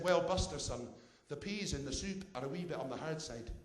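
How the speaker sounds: put-on Scottish old lady; Scottish accent. Old lady grumbling to visitor about soup, Scottish accent. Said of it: "I apologise for the accent".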